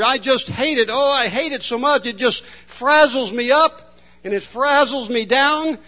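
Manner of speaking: milk every complaint like a chronic whiner; hammed-up whine of a grumbling customer; whining complainer. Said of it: "There would be a distinct whine in the voice".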